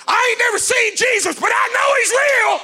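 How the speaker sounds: high, loud shouted preaching; full-force preaching. Acted. Preacher declaring to the demons in the community, shouting. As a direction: preach it hard over the music.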